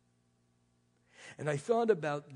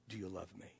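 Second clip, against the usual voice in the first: much lower and softer; hushed question; 8 semitones lower, 11 dB quieter than usual. Acted. Jesus asking Peter the third time, hushed. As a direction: ask it barely above a breath.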